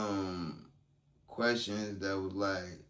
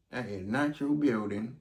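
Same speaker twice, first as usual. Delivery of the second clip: ordinary delivery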